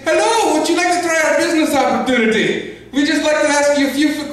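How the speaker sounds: high-pitched phone salesman; foreign telemarketer impression. Scam caller pitching a business opportunity, high put-on voice. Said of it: "It's this guy calling me".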